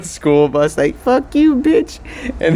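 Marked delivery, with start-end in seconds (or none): 1.0-2.0 put-on high, yelped kid insult; kid yelling. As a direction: yell it like a bratty kid. Kids yelling from school bus.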